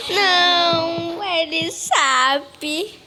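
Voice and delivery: loud, slow, high crying wail; panicked wail. Real, not acted. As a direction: wail in fear as she is caught. Caught, cries out.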